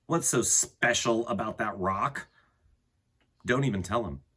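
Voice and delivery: ordinary delivery, nothing marked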